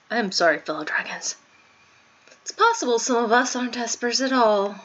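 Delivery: ordinary talk, nothing marked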